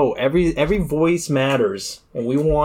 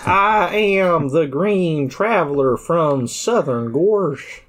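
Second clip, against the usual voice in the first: hammed-up Southern drawl, slow; Southern accent; 4 semitones higher, slower than usual. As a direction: play a Southern alien host. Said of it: "We got accents just like you Earthlings".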